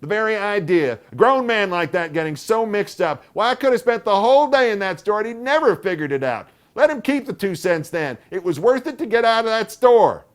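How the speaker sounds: very high, loud indignant aunt; outraged rant on the street; aunt letting go. A narrator's reading. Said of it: "let herself go".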